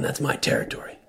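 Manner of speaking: low unvoiced threat; menacing whisper. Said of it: "hostile man on the roadside".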